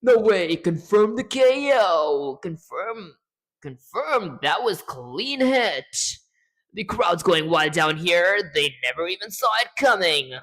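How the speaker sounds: loud, high hyped radio call. Shown as a demonstration. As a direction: call it like a live announcer. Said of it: "in a radio voice".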